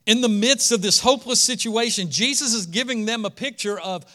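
forceful preaching. Real, not acted. Preacher offering hope in a hopeless situation, impassioned. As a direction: preach it out with rising conviction.

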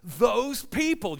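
worked-up, high-pitched exasperated rant; frustrated. Retold or imitated. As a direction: vent frustration at everyone around you, pitched high. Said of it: "And I'm frustrated. Why?".

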